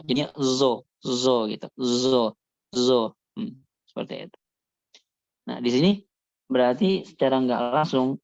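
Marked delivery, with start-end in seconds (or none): 0.0-3.1 zo said like a layman's dha; sad like common dha. Shown as a demonstration. Teacher drilling the zo sound for students. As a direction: repeat zo with the sad-zai blend. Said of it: "sebagaimana orang awam mengucapkan dha".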